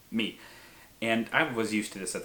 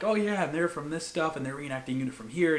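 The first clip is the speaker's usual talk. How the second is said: higher, eagerly chattering imitation of an excited man; super happy; 7 semitones higher than usual. Blacksmith telling a lady about his reenactor visitors, super happy. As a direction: gush like a delighted enthusiast being quoted. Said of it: "he's, like, super happy".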